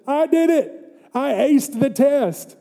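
high, crowing boast; high-pitched imitation of bragging; mock triumph. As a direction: act out a victor's self-congratulation. Acted boast of Abraham.

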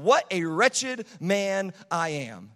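slow, loud, every word weighted; exclaimed slowly. Shown as a demonstration. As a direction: cry it out like an exclamation point. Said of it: "Exclamation point".

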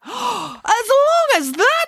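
very high, drawn-out outrage; appalled disbelief. Acted. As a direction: wail it slowly in exaggerated shock. Nanny appalled at three minutes.

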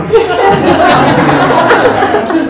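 a high, loud burst of laughter. Real, not acted. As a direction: crack up at the memory. Laughing at Joel's reply.

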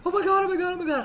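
high, loud frantic babble; panicked owner. Retold or imitated. Dog owner coming up to bleeding man, high panic. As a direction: blurt it over and over in mock panic.

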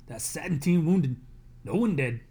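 loud report shouted on the run; calling out. Acted. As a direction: shout the tally across. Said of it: "a man calls out".

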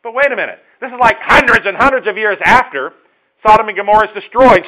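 loud, high puzzled catch; sudden realization. Pretend. Preacher voicing a listener catching the oddity, loud high surprise. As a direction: stop short, suddenly puzzled. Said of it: "then you thought for a minute".

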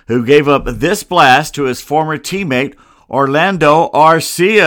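usual speaking voice

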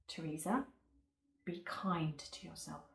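soft, hushed reassurance; gentle reminder. Acted. Somebody reminding the worn-out speaker, gently and softly. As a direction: remind tenderly, almost whispering by the end.

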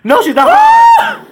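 high, slow, shouted denial; emphatic high shout. Real, not acted. Friend asks if she's hard, she shrieks no. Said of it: "Why you say it like that?".

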